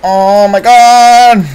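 high, slow, drawn-out exclamation; shocked yell. Real, not acted. Streamer reacting to the play, shocked drawn-out yell. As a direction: stretch it into a high cry.

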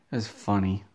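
usual speaking voice

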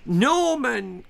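high, croaky cough of a word; coughing old king. A narrator's reading. Frail king insisting, coughing. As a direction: cough the name out. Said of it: "coughed the king".

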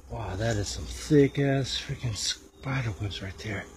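ordinary talk, nothing marked

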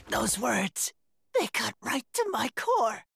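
low, crushed reaction; wounded. Acted. Coward stung by a little kid's scolding, wounded and low. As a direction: sink down, deeply hurt.

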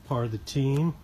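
normal talking voice

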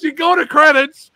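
usual speaking voice

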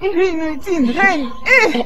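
high, thrilled outburst; excited exclaiming. Acted. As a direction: squeal over the royal news. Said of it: "Arrête de crier".

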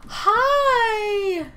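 gasp, then a high, loud hello; thrilled to hear a friend; excited greeting. Real, not acted. Greets friend joining voice chat.

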